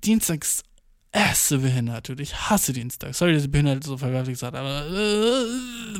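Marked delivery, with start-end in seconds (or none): 4.9-6.0 long drawn-out groan, rising high; disgusted groan. Real, not acted. Podcaster venting hatred of Tuesdays, disgusted. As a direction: drag out a noise of loathing.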